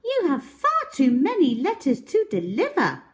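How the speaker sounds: high, fast and chirpy; high, quick helpful chatter; quick little girl voice. Acted. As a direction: rattle it off high and eager. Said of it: "she said".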